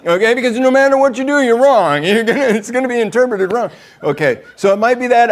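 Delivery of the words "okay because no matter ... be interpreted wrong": fast, high, loud and laughing; laughing warning. Real, not acted. Preacher warning husbands, fast laughing and loud. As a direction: blurt it out through a laugh.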